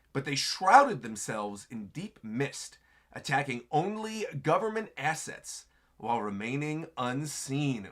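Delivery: slow, dramatic narration read; story narration. Acted. Streamer reading the chapter's narration aloud, slow. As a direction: read it like a legend. Reads narration box slowly.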